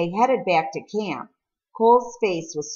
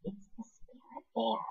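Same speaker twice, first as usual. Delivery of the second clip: soft hushed whisper, much softer; 6 semitones higher, 13 dB quieter than usual. A narrator's reading. Cole whispers to Peter on seeing the bear, hushed. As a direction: breathe it out in awe. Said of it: "Cole whispered louder".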